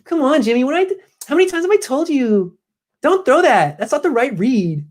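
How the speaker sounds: high-pitched mock whine, scolding; Kyle Shanahan. Host plays coach criticizing Jimmy in quarterback room, whining. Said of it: "does Kyle whine like that in the Q B room".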